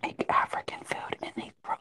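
soft unvoiced whisper; ASMR whisper. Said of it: "Use your A S M R voice".

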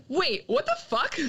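high, startled mock outburst; Peter's alarm. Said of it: "And he's like".

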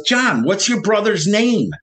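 high-pitched insistent questioning; imitated urgency, pitch jumping up; urgent friend, retold. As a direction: act out a worried friend pressing for an answer. Friend urging confused man on phone.

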